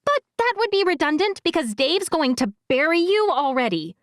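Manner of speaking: big sister scolding her brother; high, exasperated girl's voice. A narrator's reading. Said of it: "the girl said".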